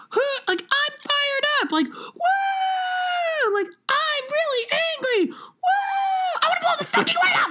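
way up high, whooping and yelling, hammed-up. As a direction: shriek it like a fired-up diva mocking herself. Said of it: "the way that she said it sounded so funny".

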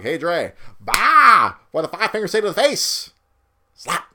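Chappelle skit quote. Retold or imitated. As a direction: deliver it like a comedy bit. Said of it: "Too much Chappelle".

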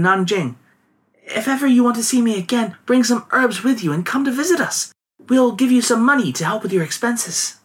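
put-on high, gentle young woman. A narrator's reading. Voiced girl explaining.

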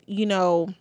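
ordinary talk, nothing marked

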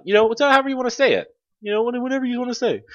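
high, quick mock executive voice; Yves Guillemot. Imitating the company boss answering employees, high and rapid. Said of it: "Yves Guillemot was like".

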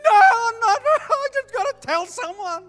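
very high, very loud sobbing shout; acted crying shout. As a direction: blurt it out through the tears. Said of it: "he's bawling his eyes out".

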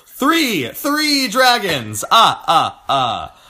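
high, drawn-out exclamation, then staccato laugh; hammed-up gleeful counting voice; theatrical counting. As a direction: count it out grandly, stretching the words. Mock counting flourish with staccato laugh.

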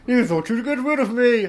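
high, loud teasing triumph; gleeful taunt. Pretend. High and loud, taunting the cohost who shut him out. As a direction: gloat like a returning pest. Bursting back into the room.

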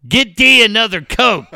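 loud order. Retold or imitated. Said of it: "just goes".

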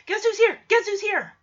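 high, eager announcement; excited. Retold or imitated. Mister Strobridge bursting out from the curtain, high and excited. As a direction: bounce with glee. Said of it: "Mister Strobridge is so excited".

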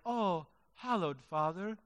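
hushed, put-on reverent prayer voice with a hint of British; mock pious prayer. Preacher mocks people praying in a put-on holy voice, hushed and solemn. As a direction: lampoon a churchy, solemn prayer tone. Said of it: "change my voice to sound perhaps a bit British".

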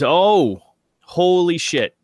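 startled, impressed exclamation; real surprise. Real, not acted. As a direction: react to an unbelievably lucky roll. Player reacts to a great roll.

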